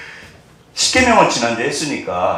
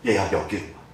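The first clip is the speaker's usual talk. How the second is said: tender call; 7 semitones lower, 9 dB quieter than usual. Acted. God calls the child.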